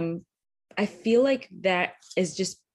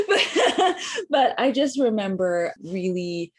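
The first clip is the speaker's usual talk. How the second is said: high, loud laugh breaking into words; 5 semitones higher, 4 dB louder, slower than usual. Real, not acted. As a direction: laugh through the start of the sentence. Laughs mid story.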